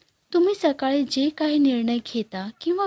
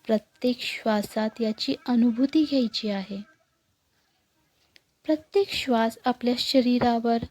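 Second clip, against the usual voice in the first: guided meditation. Acted. Guide leading listeners through morning breathing meditation, calm and unhurried. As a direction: guide them gently into stillness, leaving long pauses.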